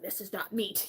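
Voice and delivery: a deadpan husband, voice dropped and quiet; imitating husband. Husband tasting the lentil swap, low and soft. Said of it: "He's like".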